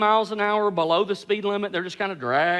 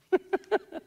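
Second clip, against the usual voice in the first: high, light laugh; brief amused laugh; short chuckle; 10 semitones higher, 6 dB quieter than usual. Real, not acted. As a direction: chuckle softly at your own point. Chuckles after his own irony.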